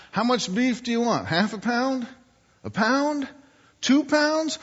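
high, rising offers, climbing with each amount; butcher questioning a dog. Acted. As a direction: coax an answer out of a dog, pitch stepping up. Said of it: "the butcher says".

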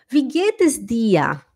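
wie geht es dir slow, long i in wie; careful German. Shown as a demonstration. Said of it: "Não".

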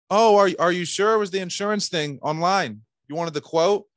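high, loud, unsure questioning; overeager, pleading check with the client; wrong sales tone. Acted. Salesman asking a lead about the quote, overeager and unsure. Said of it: "But if my tone was".

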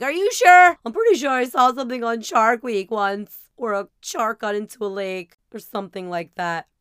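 frantic worrying about lake sharks; anxious. Retold or imitated. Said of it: "I'm like".

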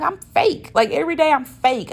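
high loud cry of self-disgust; exasperated realization. Retold or imitated. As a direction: blurt it out, shocked at yourself. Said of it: "and I'm like".

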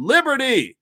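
shouted high and loud; screamed. A narrator's reading. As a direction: cry it out like a battle call. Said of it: "and screamed".

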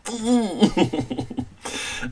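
high, soft, long chuckle; high-pitched trailing scoff; derisive laugh. Acted. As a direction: laugh off a jibe. Laughing off a rival's jibe.

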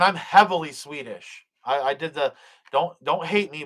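normal talking voice

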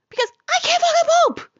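very high, fast, loud outburst; exasperated shout. Retold or imitated. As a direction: yell it in acted desperation. Shouted climax of retold quarrel.

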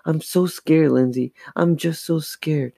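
high, frightened protest, sinking into a plea; losing control. A narrator's reading. Scared girl to her twin after the voice, upset pleading. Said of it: "feeling herself lose control".

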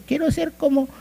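high-pitched mock fan voice; mimicked wannabe in a squeaky-high pitch; adoring fan. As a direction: gush like a starstruck follower, up high. Acting out an idol worshipper.

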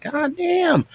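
very high falsetto disbelief; mock shock. Reacting to a neighbor's driveway rant, high mock shock. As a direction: squeak it out, high and stunned.

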